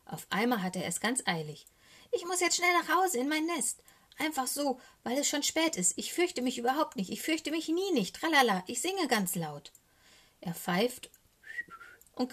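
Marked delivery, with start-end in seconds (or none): 2.1-9.7 high-pitched little raven putting on courage; high, jittery excuse-making; nervous bravado. A narrator's reading. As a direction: insist you are not scared, anxiously. Scared raven pretends not to fear.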